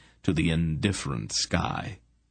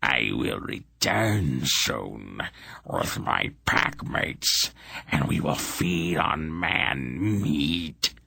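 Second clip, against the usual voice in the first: much lower, slow monster voice; menacing beast promising to return; rumbling hound; 3 semitones lower, much slower than usual. A narrator's reading. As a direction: growl the threat out slowly. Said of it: "the fiery-eyed hound rumbled".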